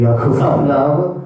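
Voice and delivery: loud, slow call of Satnam; call to congregation. Real, not acted. Preacher calling the congregation to say Satnam. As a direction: lead the crowd in the chant.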